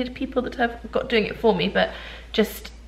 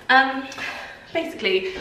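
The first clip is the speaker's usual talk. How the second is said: ordinary talk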